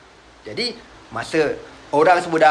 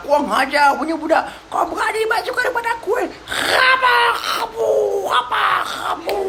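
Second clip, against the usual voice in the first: shrill furious shouting, much higher; raging bully. Acted. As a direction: scream down at the small boy. Abu Jahal beats Abdullah. (Abu Jahal menengking dengan marah)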